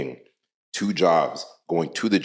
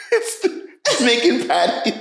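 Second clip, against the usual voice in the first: laughing; slower than usual. Real, not acted. Cracking up at her answer.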